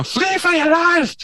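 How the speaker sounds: very high, cartoonish squeal of alarm; hammed-up high-pitched panic; mock panicked cry. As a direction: squeal it like a tiny fleeing creature. Mimics fleeing Oompa Loompas.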